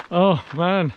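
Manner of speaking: normal talking voice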